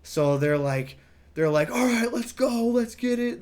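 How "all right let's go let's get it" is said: put-on kids' voices. Kids voiced, eager.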